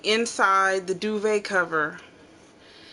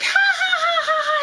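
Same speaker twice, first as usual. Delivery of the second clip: high, loud mock cackle; a little girl laughing her head off; playing the giggling kid, much higher and louder; hysterical child giggle; 4 dB louder than usual. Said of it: "She's giggling hysterically".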